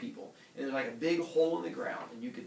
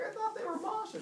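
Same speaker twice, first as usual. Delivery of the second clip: very high, squeaky protest; desperate excuse. Retold or imitated. Heracles defending himself to villagers, high squeaky excuse. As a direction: whine it out in mock distress.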